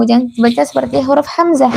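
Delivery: ordinary talk, nothing marked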